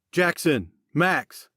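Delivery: high calling out; shouting. Acted. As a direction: call across the cave. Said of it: "Colton shouted".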